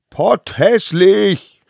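high, loud, drawn-out taunt; sing-out playground shout; jeering call. A narrator's reading. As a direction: stretch the insult out as you yell it. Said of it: "riefen die anderen kleinen Wilden".

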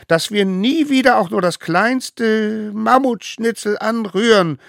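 high, loud solemn call; leading an oath. A narrator's reading. Smallest one calling the oath. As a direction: proclaim each word.

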